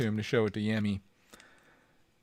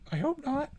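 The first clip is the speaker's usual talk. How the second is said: much higher, thin squeak; high squeaky; 14 semitones higher, 4 dB quieter than usual. Shown as a demonstration.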